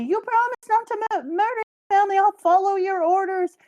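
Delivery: put-on baby voice. Retelling a baby's lines, high childlike squeak. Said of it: "says the baby".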